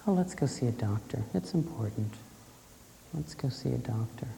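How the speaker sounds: quiet calm push to see a doctor; calm, hushed urging. Retold or imitated. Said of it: "I very calmly turned to him".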